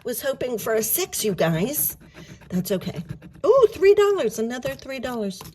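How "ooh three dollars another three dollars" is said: excited. Real, not acted. Delighted at the amount.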